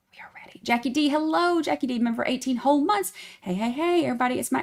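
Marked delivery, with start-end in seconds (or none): 0.1-0.6 whisper. Acted. Whispered reply.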